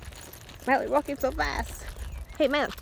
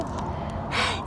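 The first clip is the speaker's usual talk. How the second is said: short, breathy gasp of delight; quick sharp intake of breath; excited gasp. Real, not acted. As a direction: gasp again, overwhelmed. First sight of the groomed dog.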